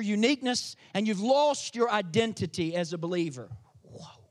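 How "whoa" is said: awed whisper. Real, not acted. Awed pause after big point.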